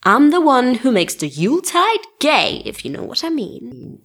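campy innuendo. Acted. Camp coming-out innuendo.

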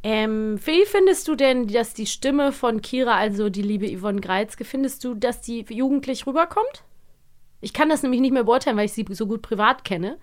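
ordinary delivery

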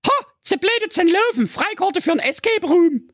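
sehr hohe, schnippische Mädchenstimme; verwöhnte Prinzessin. Acted. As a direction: zickige Teenie-Prinzessin. Prinzessin spricht. (die Prinzessin lehnt den Freier spöttisch ab)

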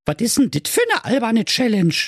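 high-pitched, bewildered she-hedgehog; baffled. Acted. She-hedgehog asking about the silly challenge, baffled. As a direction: question it in a high, puzzled voice. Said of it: "fragte verdattert".

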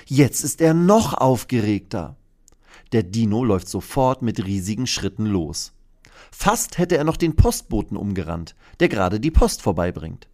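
plain reading voice

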